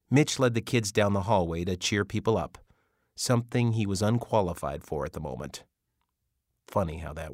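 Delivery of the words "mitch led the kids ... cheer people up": ordinary delivery, nothing marked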